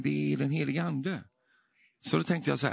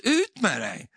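high, sudden imitated order; re-enacted exorcist's cry; retold command; 10 semitones higher, 5 dB louder, slower than usual. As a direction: throw it out on a raised pitch.

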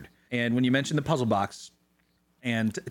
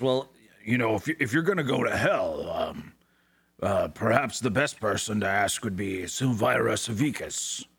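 Little One voice; 2 semitones lower than usual. Acted. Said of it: "He says".

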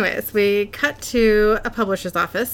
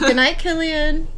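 motherly good night; 7 semitones higher, slower than usual. Real, not acted. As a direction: tuck a little one in, pitched up and slow. Saying good night to child.

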